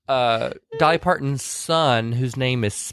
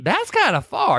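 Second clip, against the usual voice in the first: higher, surprised southern lady; surprised Dolly; 8 semitones higher, slower than usual. Retold or imitated. Dolly reacting to the long walk, surprised. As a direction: sound impressed and a bit worried. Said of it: "She goes".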